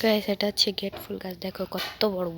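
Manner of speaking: ordinary talk, nothing marked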